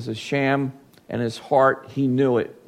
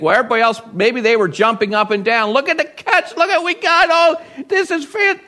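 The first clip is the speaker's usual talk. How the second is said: much higher, giddy mock excitement; excited cheering; 9 semitones higher, faster than usual. Fishermen jumping up and down over the catch, giddy and much higher. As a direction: gush over the big catch.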